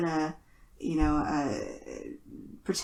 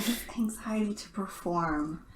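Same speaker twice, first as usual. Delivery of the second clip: soft whispered aside; 5 dB quieter than usual. Real, not acted. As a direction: drop to a whisper to clarify. Clarifying a joke, aside.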